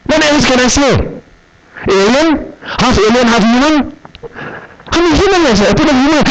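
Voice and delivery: indignant outburst. Retold or imitated. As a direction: raise the pitch, half laughing at the absurdity. Indignant retelling at customs officer.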